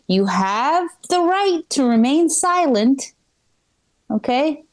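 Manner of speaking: Miranda rights. Pretend. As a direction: solemnly read the rights like an accented officer. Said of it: "read me my Miranda rights".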